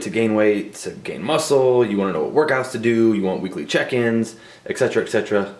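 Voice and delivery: ordinary delivery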